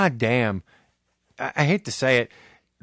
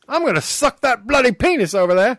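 hammed-up British accent, higher; bad British accent; 8 semitones higher than usual. Joking to co-host about Brits, hammed-up British accent. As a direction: put on a cheesy British accent. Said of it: "Unless you're in Britain".

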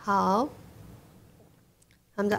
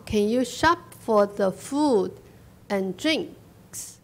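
slow, phrase by phrase with pauses; drawn-out words, pausing between chunks; slow model reading; slower than usual. Shown as a demonstration. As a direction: model the question slowly for learners. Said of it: "跟我念啊".

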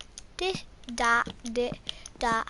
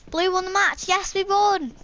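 higher, louder victory cheer; excited cheer; 5 semitones higher, 5 dB louder than usual. Real, not acted. Gamer cheering as the team wins the match, loud high cheer. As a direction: celebrate the win.

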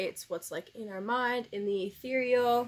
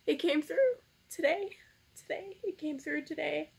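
choked-up happy tears; 6 semitones higher than usual. Real, not acted. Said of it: "didn't mean to cry on camera".

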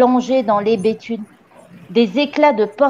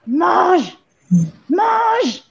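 high, drawn-out shout; yelled command; 8 semitones higher than usual. A narrator's reading. Le Gourdin forcing the gamin to eat, high yell. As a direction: yell one word, higher each time. Headmistress yelling at boy to eat.

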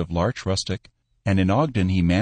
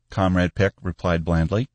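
usual speaking voice

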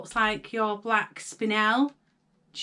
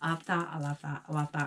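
softer, lower mock greed; softer, lower muttered i'll have that; quick greedy inner voice; 8 semitones lower, 8 dB quieter, faster than usual. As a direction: rattle it off like grabbing everything in sight. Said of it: "I was like".

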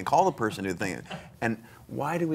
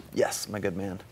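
usual speaking voice

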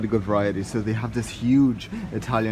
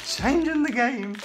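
slow, much higher drawn-out announcer catchphrase; 12 semitones higher, slower than usual. Pretend. Vlogger showing off his ciabatta, slow high catchphrase. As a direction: stretch "Changing" out slowly, high. Catchphrase after bread reveal.

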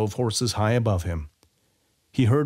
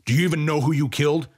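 quick, higher cruel question; cruel smile; 5 semitones higher, 3 dB louder, faster than usual. A narrator's reading. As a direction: ask it fast, cruelly smiling. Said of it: "asked with a cruel smile".

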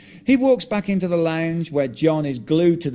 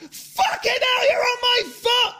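screaming in pain. Retold or imitated. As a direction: yell it as the car crushes your foot. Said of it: "I'm screaming at him".

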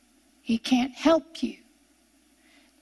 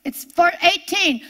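usual speaking voice

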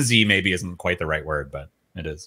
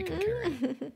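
mocking incredulous; 12 dB quieter than usual. Mocking NPC, high incredulous.

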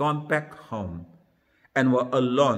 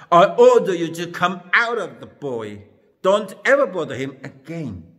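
firm, louder, higher-pitched order; stern command; 3 semitones higher, 7 dB louder, faster than usual. A narrator's reading. Jesus orders an evil spirit out of a boy, sternly. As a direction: read it as a forceful command. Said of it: "he spoke sternly to the evil spirit".

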